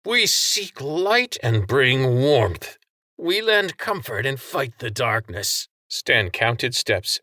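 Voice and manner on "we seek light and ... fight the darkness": slow, raised creed to the crowd; proclaiming. A narrator's reading. Franks declaiming the church's creed to the cameras. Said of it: "roar into the crowd".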